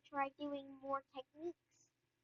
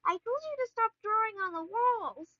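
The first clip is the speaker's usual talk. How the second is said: loud, higher-pitched telling-off; imitated parental reprimand, raised voice; retold scolding; 6 semitones higher, 8 dB louder than usual. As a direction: scold like a fed-up mom.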